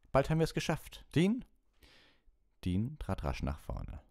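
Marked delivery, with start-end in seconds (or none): 0.1-1.4 high, lively teacher's call. A narrator's reading. Lupin calls Dean up next, calling out. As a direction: urge them on, voice pitched up. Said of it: "rief Lupin".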